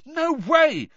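high, loud, furious outburst; furious refusal. A narrator's reading. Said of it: "said Harry furiously".

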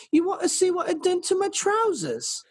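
put-on very high country girl accent. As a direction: repeat it in a squeaky rural twang. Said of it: "So you get the accent".

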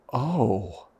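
soft, taken-aback oh; retold stunned reaction. Neighbor learning the coach's real pay, quietly stunned.

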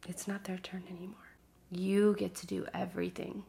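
soft quick whisper, right into the mic; breathy whispered reassurance. Real, not acted. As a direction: hush it like a secret meant just for them. Intimate encouragement to viewer.

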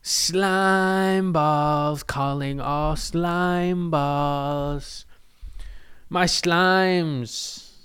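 long, sustained call with every vowel stretched; drawn-out call. Pretend. Host summoning the listeners, slow drawn-out call. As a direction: hail them slowly, holding each word out.

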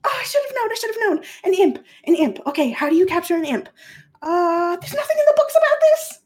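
very high, loud, frantic; hammed-up frantic scholar; freaking out. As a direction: panic aloud, searching for an answer. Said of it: "Hasno is, like, freaking out".

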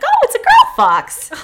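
high, loud delighted squeal; suddenly thrilled, voice shooting up; excited discovery. Real, not acted. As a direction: burst out with surprised delight. Delighted discovery mid-story.

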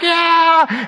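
high excited fan yell; lightly acted stadium whoop; mock cheer. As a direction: cheer the winning score.

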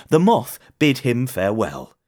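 ordinary delivery

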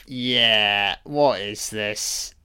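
normal talking voice

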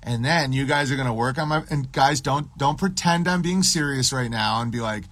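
ordinary delivery

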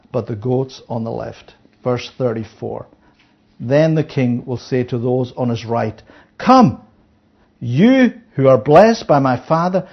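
low, measured reading of the verses; scripture reading. Acted. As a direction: read it out like holy writ.

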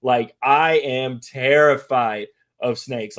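ordinary delivery

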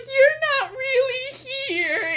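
high, drawn-out mock wail; tearful disbelief. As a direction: wail in disbelief, acting out the bawling. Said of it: "just fucking bawling in my living room".

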